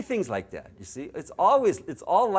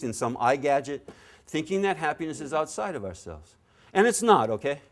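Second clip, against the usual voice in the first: ordinary talk, nothing marked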